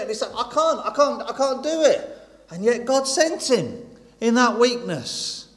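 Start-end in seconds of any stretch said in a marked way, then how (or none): 0.3-2.2 higher, faltering protest; a lightly voiced, frightened Moses who can't get it out; stammering Moses. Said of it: "maybe he stuttered a little bit".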